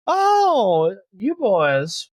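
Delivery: put-on high, loud, delighted older lady; fond older woman. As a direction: greet the boys like a fond aunt. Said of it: "she says".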